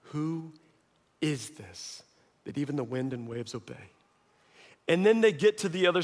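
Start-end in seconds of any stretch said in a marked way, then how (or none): 0.1-3.9 low, very soft, awestruck voice; hushed awe. Acted. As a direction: drop to a hush of wonder. Disciples awed by the calm.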